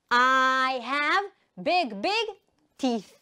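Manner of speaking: drawn-out "I have", then punchy words; slow repeat-after-me delivery; slow model sentence. Shown as a demonstration. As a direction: chant it slowly for learners. Teacher chants sentence for repetition.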